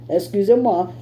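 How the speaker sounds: normal talking voice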